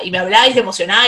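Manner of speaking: ordinary talk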